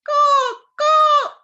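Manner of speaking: high, loud cawing like a crow; shrill bird cry in the forest; crow's caw. A narrator's reading. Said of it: "said the crow".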